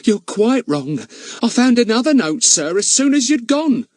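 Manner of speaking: high, chatty housekeeper protesting. Acted. Charwoman contradicting the village policeman, high and eager. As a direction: pitch her up like a bustling charwoman.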